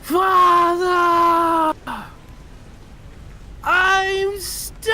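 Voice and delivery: high, drawn-out desperate shriek; screaming from below. Acted. Starving son screams to his father from underground.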